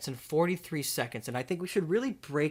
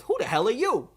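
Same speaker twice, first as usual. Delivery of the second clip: indignant challenge; 7 semitones higher, 3 dB louder than usual. Acted. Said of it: "He goes".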